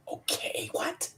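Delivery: hushed, baffled aside; confused whisper. Retold or imitated. Rookie answering agent's odd instruction, confused whisper. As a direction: whisper it as if totally lost.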